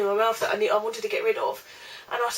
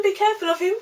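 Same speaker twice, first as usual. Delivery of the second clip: dad's warning; 11 semitones higher, faster than usual. Retold or imitated. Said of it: "my dad told him".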